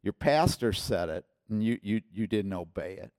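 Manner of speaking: ordinary talk, nothing marked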